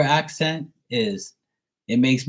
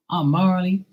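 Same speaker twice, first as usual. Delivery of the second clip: lightly imitated Georgia accent, pitched up; Marley; 6 semitones higher than usual. Teasing a friend about her accent, imitated Georgia accent. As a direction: put on a higher Georgia twang. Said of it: "uh, Georgia accent".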